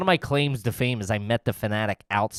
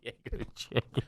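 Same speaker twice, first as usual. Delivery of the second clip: whisper; 14 dB quieter than usual. Real, not acted. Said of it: "whispered aside".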